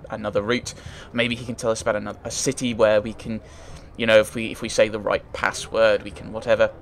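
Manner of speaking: ordinary delivery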